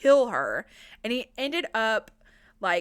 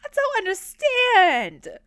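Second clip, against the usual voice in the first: loud, slow, high incredulous complaint; exasperated; 11 semitones higher, 8 dB louder, slower than usual. Real, not acted. Narrator reacting to the maiden line, loud disbelief. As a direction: drag it out in disbelief. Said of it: "What the hell are you talking about".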